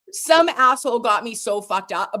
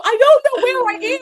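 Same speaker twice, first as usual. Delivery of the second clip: higher and louder, lost; mock panic; 8 dB louder than usual. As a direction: cry out that you're lost. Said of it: "And I go".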